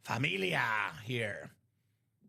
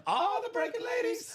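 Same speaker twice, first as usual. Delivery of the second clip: laughing high echo. Real, not acted. Host echoing co-host's chant, laughing and high. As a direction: squeal it high through a laugh.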